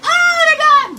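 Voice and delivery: high, loud gasp of delight; excited shriek. Retold or imitated. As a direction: squeal it like a drag queen. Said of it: "You're like".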